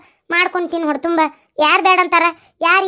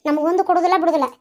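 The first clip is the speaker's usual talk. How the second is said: ordinary talk, nothing marked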